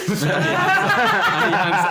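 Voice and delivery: slow, high, laughing through it; can't hold the laugh in. Real, not acted. GM laughs mid-line.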